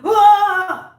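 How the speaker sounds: loud, high mock scream; imitated scream. Host imitating the tennis player's on-court screaming, loud mock scream. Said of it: "We watch a lot of screaming".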